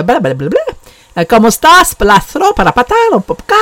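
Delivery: mock Spanish coworker. Coworker chattering at her in the break room, mock Spanish. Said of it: "she goes".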